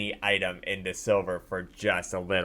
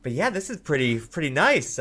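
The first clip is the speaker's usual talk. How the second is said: higher, swooping, excited appraisal; impressed blacksmith; 6 semitones higher than usual. Acted. Blacksmith admiring the offered blueprints, higher-pitched and enthusiastic. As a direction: think aloud eagerly in a higher voice. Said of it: "she turns to her and says".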